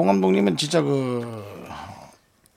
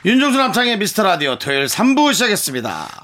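radio announcer; 6 semitones higher than usual. Acted. Announces show segment start.

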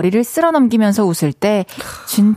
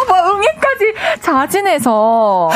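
laughing; 9 semitones higher, slower than usual. Real, not acted. Laughing at the baby wail.

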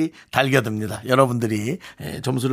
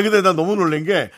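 ordinary delivery

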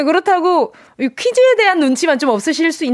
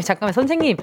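usual speaking voice

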